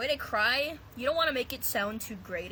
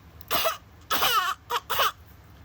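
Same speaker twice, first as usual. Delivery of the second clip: put-on high louder baby sob bursts; wailing infant cough-cries; baby cry coughs; 13 semitones higher, 7 dB louder than usual. Shown as a demonstration. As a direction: choke out varied baby sobs. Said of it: "And you gotta go like".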